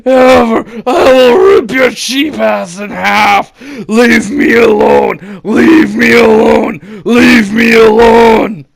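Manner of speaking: furious high screaming; raging yell, slow and cracking. Retold or imitated. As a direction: scream it, losing control. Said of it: "Me still screaming and stumbling over my words".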